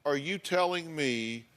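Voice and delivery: normal talking voice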